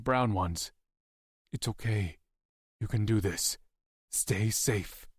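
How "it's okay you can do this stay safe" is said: softer, gentle goodbye; tender farewell. Acted. Dying prisoner comforting Maisie, soft tender farewell. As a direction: soothe her as you go.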